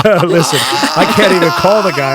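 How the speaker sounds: loud, high-pitched objection over laughter; exasperated protest. Real, not acted. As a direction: raise your voice in comic exasperation. Protests question amid everyone's laughter.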